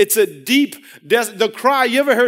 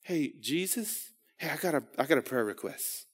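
much softer, low, offhand request; casual mumble; 4 semitones lower, 14 dB quieter than usual. Pretend. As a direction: toss it off like a passing favor. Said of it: "He didn't, he didn't just".

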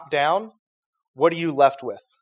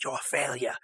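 soft, low mock Ramsay put-down; a hint of a scornful chef; Gordon Ramsay; 4 semitones lower, 12 dB quieter than usual. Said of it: "Gordon Ramsay will just be like".

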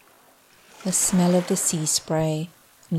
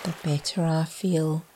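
usual speaking voice

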